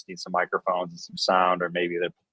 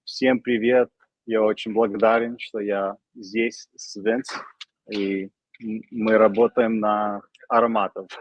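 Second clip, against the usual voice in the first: fluent native Russian, slightly halting; speaking Russian; 2 semitones higher, slower than usual. Shown as a demonstration. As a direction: speak Russian naturally, a bit rusty. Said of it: "Let's hear some Russian".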